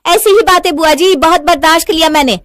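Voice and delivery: high, loud, fed-up retort; angry. Acted. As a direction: throw it back at her, loud and high. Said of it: "गुस्से में नैना". (ग़ुस्से भरा तीखा जवाब)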